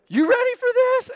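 loud, very high hype call; hammed-up athlete's challenge; imitated gladiator athlete. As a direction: fire up the crowd as the showman. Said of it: "he talked like this".

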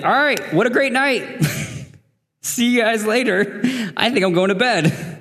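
high, breezy let's-wrap-this-up voice; bright fake host send-off, a notch up; mock cheerful brush-off. Host at the dinner party bowing out, mock cheerful. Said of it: "I would be like".

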